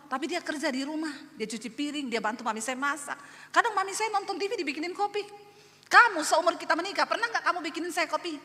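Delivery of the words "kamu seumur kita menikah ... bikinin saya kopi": higher-pitched retold wifely complaint; acted indignant nagging; accusing. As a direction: confront the husband, starting loud. Wife accuses husband in quarrel.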